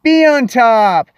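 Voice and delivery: loud, drawn-out reveal; slow, punched-up title reveal; big announcement. Pretend. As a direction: announce it grandly after the drum roll. Big reveal after drum roll.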